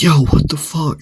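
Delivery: re-enacted angry challenge; angry protest. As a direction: raise the pitch in mock outrage. Friends react angrily to knockdown.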